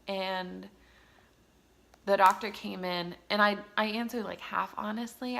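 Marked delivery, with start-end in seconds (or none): none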